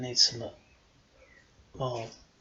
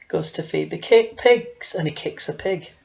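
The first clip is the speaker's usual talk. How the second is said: usual speaking voice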